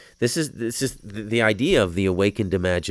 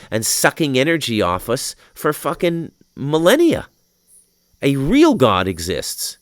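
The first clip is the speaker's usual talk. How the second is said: worked up; 5 semitones higher, 7 dB louder than usual. Real, not acted. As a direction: pound out each word, really fired up. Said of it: "I'm getting so fucking worked up".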